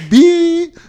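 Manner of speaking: high loud mock bleep sound; censor bleep. Shown as a demonstration. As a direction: imitate a TV censor beep. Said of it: "Another beep".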